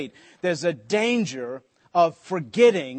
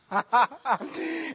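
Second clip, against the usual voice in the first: high, real chuckle at his own joke; self-mocking laugh; 9 semitones higher, 3 dB quieter than usual. Real, not acted. Jokes about being told to leave.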